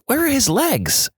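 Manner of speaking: high, panicked repeat of the question; panicked muttering. Retold or imitated. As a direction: let the second "Where are his legs?" jump way up in alarm. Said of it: "repeatedly muttering".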